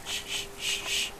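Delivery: soft repeated shh; quiet little shushes; soothing shushing. Pretend. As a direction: hush it gently, over and over. Hushing before counting to jump.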